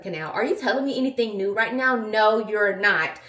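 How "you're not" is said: usual speaking voice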